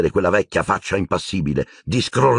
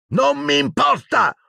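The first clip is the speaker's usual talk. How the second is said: much higher, slow furious yell; shouting. A narrator's reading. Boy screaming at the portraits while smashing things. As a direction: hurl it at the portraits. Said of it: "gridò loro Harry".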